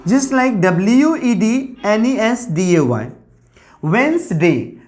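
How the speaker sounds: usual speaking voice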